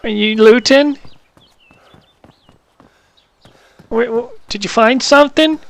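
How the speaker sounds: high-pitched morphed voice; squirrel voice. Acted. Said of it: "talk in this squirrel voice".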